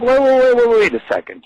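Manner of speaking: high, loud burst of rapid-fire waits; urgent objection. Acted. Man objecting to people who think he's stupid, urgent objection. Acts out halting a liar.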